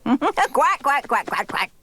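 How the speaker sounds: quacking like a duck; playful duck quack. Acted. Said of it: "quack like a duck".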